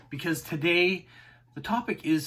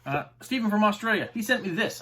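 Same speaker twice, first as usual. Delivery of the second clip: ordinary delivery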